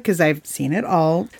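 normal talking voice

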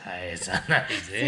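low, slow, smug comedian voice; Sugi-chan impression. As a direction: boast in a wild, cocky drawl. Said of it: "スギちゃん". (低くゆっくりしたワイルドな芸人口調)

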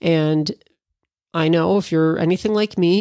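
ordinary talk

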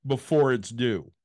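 slow, halting phrases with long pauses; retold agent's drawn-out answer; deliberate explanation. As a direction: spell it out piece by piece, very slowly.

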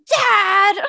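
high whiny cry to Dad; mock disgusted protest. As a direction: imitate a grossed-out kid wailing. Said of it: "you'd be like".

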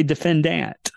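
defendant with a full, stressed DANT; old-days pronunciation. Shown as a demonstration. Jokes about his dinosaur days, defendant with old DANT ending. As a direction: finish de-fen-DANT on a clear "ant". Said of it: "we used to say".

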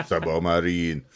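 drawled Submarine, laughing, slow; mock accent. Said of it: "no more cultural appropriation of accents".

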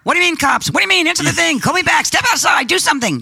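texting a friend who won't answer, urgent; frantic high texting. Retold or imitated. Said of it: "I'm texting her".

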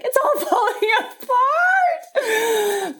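high, drawn-out hammed-up wail; mock wailing. Host mocks her own crying to her guest, hammed-up wail. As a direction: playfully fall apart in a loud laughing cry. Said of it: "being the one who's like".

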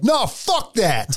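high, drawn-out mock yell; retold yell. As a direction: imitate an outraged man objecting. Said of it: "still yelling at the cashier".

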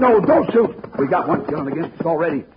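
high, loud frantic plea; panicked pleading. Acted. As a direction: beg him desperately not to shoot. Begging partner not to shoot.

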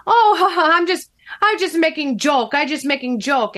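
mock Russian accent with a laugh, starting high. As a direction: laugh it off in imitation Russian English. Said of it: "had a very heavy Russian accent".

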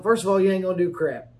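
normal talking voice

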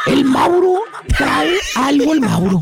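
drawn-out suspicion, dropping low at the end; mock-detective musing; slow deduction. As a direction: stretch it like a dawning realization. Acting out the conclusion reached mentally.

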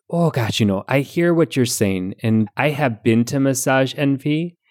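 Midwestern mom giving folksy advice; Midwestern mom accent. Acted. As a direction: answer like an understanding Midwestern mom. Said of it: "answer as a Midwestern mom".

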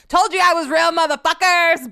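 high, loud triumphant taunt; gloating yell. Retold or imitated. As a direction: shout it straight into the camera. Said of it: "go up to the camera and be like".

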